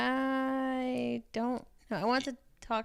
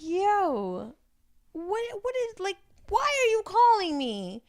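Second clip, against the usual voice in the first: incredulous; 10 semitones higher, 4 dB louder, slower than usual. Retold or imitated. Retold reply to late-night call.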